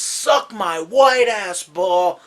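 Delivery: loud, high, drawn-out taunt; mock-furious movie quote; Happy Gilmore. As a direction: yell it slowly at a golf ball. Quotes Happy Gilmore's yell at ball.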